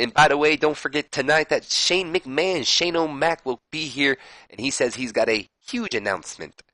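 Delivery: hammed-up Texas accent like a wrestling commentator plugging the show; shaky Texas accent impression of an old commentator hyping an announcement; bad Dusty Rhodes. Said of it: "That's a horrible Dusty Rhodes impersonation".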